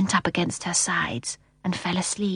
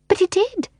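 put-on high child's protest; high-pitched boy standing his ground; 13 semitones higher than usual. A narrator's reading. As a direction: insist in a small high voice. Michael protests to nanny.

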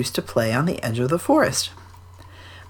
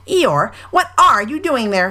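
Rabbit question; 6 semitones higher, 3 dB louder than usual. A narrator's reading. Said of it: "said Rabbit".

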